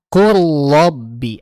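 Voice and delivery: slow, "Kul robbi" with the l melting into r; recited example. Shown as a demonstration. Teacher recites the example slowly for the class. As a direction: draw it out evenly. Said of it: "lam sukun berarti apa".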